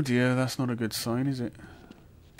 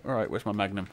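ordinary talk

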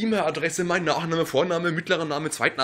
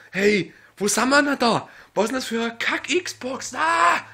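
shouting at the console; berserk rage; 4 semitones higher than usual. Real, not acted. Raging shout. (wütend brüllend)

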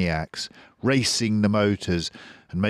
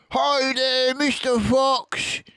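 high, slow taunting call; Bunce yelling; 4 dB louder, slower than usual. A narrator's reading. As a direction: sing out a mocking greeting from the tractor. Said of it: "yelled Bunce".

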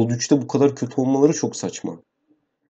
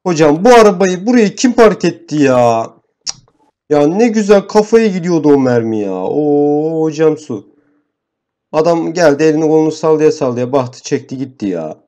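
annoyed outburst; 4 semitones higher, 5 dB louder than usual. Real, not acted. Teammate's car blocks his shot.

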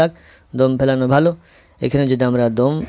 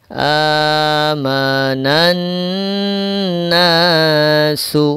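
slow Quran recitation with held nasal n; melodic, unhurried tajweed demonstration; drawn-out recitation; 2 semitones higher, much slower than usual. Shown as a demonstration. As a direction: chant it slowly, stretching vowels and the doubled n. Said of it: "এক আলিফ টান".

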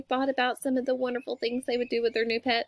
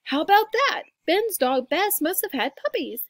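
put-on high, raised young voice; excited brother; 6 semitones higher, 4 dB louder than usual. A narrator's reading. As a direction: exclaim like a delighted boy. Brother excited at puppy news.

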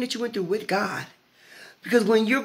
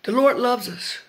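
normal talking voice

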